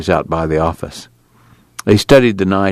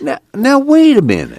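placating; 4 dB louder than usual. Acted. Al trying to stop the angry girl, high and placating.

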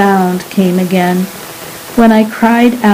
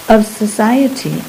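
ordinary delivery

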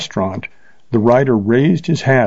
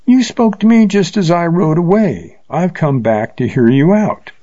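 mocking tone; 7 semitones higher than usual. A narrator's reading. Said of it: "he said in a mocking tone".

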